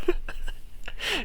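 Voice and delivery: airy high-pitched laugh; high breathy laugh. Real, not acted. Laugh after amazed quote.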